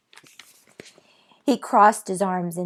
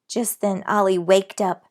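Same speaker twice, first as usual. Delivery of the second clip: plain reading voice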